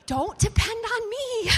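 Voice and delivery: high, loud, drawn-out mock plea; comic panicked protest. Player begging team not to count on her, mock panic. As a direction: cry it out high and slow, then laugh.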